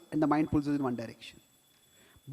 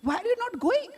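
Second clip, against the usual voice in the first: demanding. Retold or imitated. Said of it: "He says".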